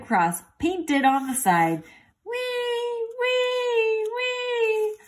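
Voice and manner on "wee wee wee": very high siren wail; high-pitched wee wee siren; siren noise. A narrator's reading. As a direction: wail like a tiny ambulance siren. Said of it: "good and loud".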